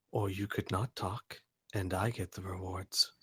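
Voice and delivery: quietly threatening, almost gentle; creepy quiet. Acted. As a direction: torturer answering his captive, creepy and soft. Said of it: "Al smiles very, very, uh, creepily and says".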